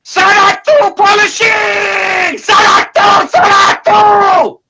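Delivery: high, slow, drawn-out yell; mock Japanese bathhouse yell. Hammed-up yell, on request from the chat. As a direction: bellow it like a bathhouse attendant calling out. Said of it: "impression of Mark Goldberg in Osaka yelling".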